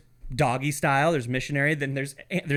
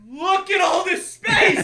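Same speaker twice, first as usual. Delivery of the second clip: high, slow, loud sarcastic yell; mock shout; 4 dB louder, much slower than usual. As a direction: bellow it slowly at the rude campers. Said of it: "I'd have been like".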